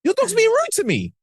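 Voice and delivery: very high-pitched aggrieved complaint; mock indignation. Complaining to a dog's owner, mock indignation. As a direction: protest in a squeaky-high, offended pitch.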